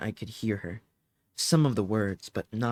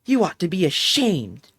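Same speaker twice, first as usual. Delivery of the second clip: indignant shout, louder and higher; yelling back; 6 semitones higher, 10 dB louder than usual. Acted. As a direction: scold the mother at full volume. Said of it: "yelled back".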